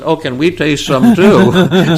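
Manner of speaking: high, loud, slow, with laughter; giggling as they ask; laughing request. Retold or imitated. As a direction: colleagues laughing as they beg a taste. Colleagues rush up laughing.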